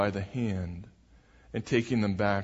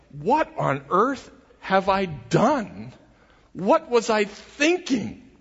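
higher, slow, dismayed self-questioning; panicked Peter; 7 semitones higher, slower than usual. Retold or imitated. Peter in the storm asking himself, slow and alarmed. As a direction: let the alarm rise as he realises.

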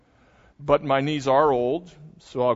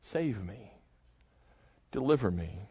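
quiet pleading; 4 semitones lower, 6 dB quieter, slower than usual. Acted. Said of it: "He says".

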